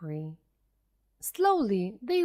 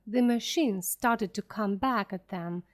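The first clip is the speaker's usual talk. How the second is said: plain reading voice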